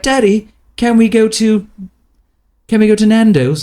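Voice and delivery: put-on high, loud British little-girl voice; British accent. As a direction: imitate a daughter pleading in a British accent. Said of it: "it swings to a British accent sometimes".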